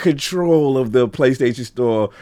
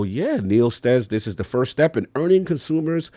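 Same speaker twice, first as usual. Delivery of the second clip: ordinary talk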